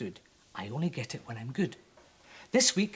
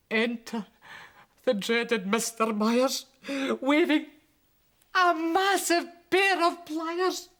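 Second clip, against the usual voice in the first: high, loud, slow ominous announcement; dramatic menace; 11 semitones higher, 5 dB louder, slower than usual. A narrator's reading. Narrator announcing the dreaded dentist, slow and looming. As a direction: draw it out like a horror entrance.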